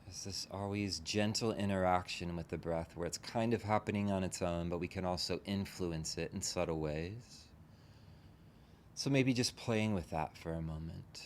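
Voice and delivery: unhurried meditation guide, long pauses between phrases; guided meditation. Real, not acted. Teacher leading a group into relaxing the body, guided meditation. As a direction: gently talk them into settling, leaving space after each phrase.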